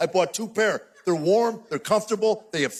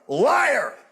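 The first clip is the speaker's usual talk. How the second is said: high-pitched comic yell of accusation; accusing shout; 5 semitones higher, 3 dB louder than usual. Retold or imitated. Husband answering wife about his missing coat, accusing shout. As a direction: burst out in mock outrage. Husband rebuts wife's gaslighting.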